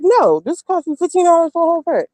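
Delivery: a touch of mock firmness, refusing the price; lightly voiced flat no to a cadger; firm refusal. She tells grown men begging for cigarettes, firm mock refusal. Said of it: "and I be like".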